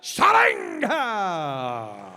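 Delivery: high shouted ring intro, name stretched; booming pumped announcer. Acted. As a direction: raise the roof on the name. Fighter walkout introduction.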